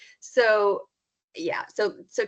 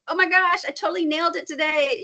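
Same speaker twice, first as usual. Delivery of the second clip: higher, louder acted elation; excited boasting; 8 semitones higher, 4 dB louder than usual. Spouse comes home and tells partner the news, excited. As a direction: burst in with good news.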